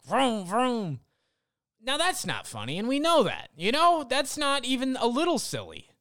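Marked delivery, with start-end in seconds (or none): 0.0-1.0 high, breathy-free vroom vroom in a put-on voice; a mild mock engine sound. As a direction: mimic the car noise once more. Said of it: "He just opened his mouth, and it was like".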